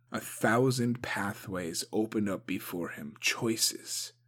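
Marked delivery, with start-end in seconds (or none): none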